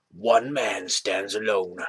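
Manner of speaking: slow, portentous trailer voiceover; drawn-out mock-epic announcer; movie voice. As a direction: intone it like a film trailer. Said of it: "my movie voice".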